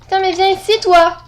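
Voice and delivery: loud, angry yell at the player she's chasing. Real, not acted. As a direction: yell at the opponent in real rage. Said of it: "Je suis trop énervée".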